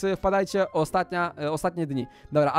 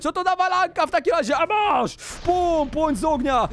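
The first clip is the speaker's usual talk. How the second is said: high-pitched, shouted attack calls; excited mock combat cry; battle shouting; 9 semitones higher, 3 dB louder than usual. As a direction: yell it as a play fighter striking. Attacking in play fight.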